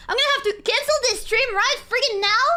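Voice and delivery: hammed-up furious streamer meltdown; shaking with anger. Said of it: "mock outrage at tag".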